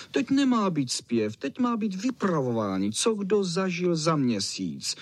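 high, insistent call; shouting terrier. Acted. Fox Terrier demands storytelling instead of singing, calling out. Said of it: "křičel Fox Terrier".

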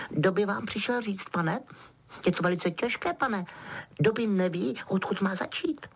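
small servant struggling to begin; Dobby, troubled house-elf. A narrator's reading. Elf telling Harry it is difficult, troubled. Dobby explains his errand.

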